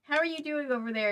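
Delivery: plain reading voice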